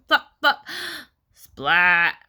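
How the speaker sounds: loud, punchy flops and a splat; falling sound effects. A narrator's reading. Narrator imitating Froggy's crash onto pumpkin, loud impacts. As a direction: punch each word like an impact.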